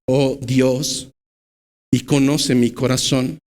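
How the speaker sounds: ordinary talk, nothing marked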